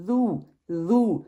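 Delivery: ذو drawn out very slowly; teacher sounding out ذو slowly. Shown as a demonstration. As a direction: stretch ذو with the tongue between the teeth. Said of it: "پیش کی طرح پڑھنا ہے".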